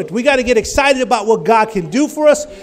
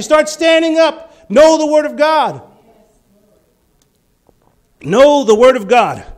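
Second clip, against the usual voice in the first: loud, driving exhortation; preaching at full voice; exhorting shout; 4 semitones higher, 6 dB louder than usual. Real, not acted. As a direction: call it out to the crowd. Preacher exhorting crowd loudly.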